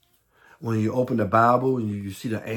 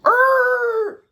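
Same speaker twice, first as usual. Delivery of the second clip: angry yell; 10 dB louder than usual. Retold or imitated. Men jump out yelling.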